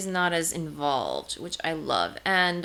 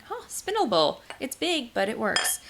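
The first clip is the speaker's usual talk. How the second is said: bright realization; 5 semitones higher than usual. Retold or imitated. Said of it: "I'm like".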